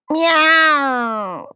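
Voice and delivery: high, loud yowling meow. A narrator's reading. The cat answers Ella with a loud, high yowl.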